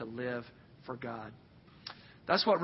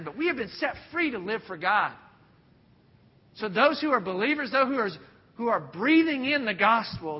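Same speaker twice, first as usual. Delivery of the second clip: rising, louder preacher's build; preaching at full force; 7 semitones higher, 8 dB louder than usual. Acted. Pastor urging believers to live for God, louder fervent preaching. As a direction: drive it home to the congregation, building.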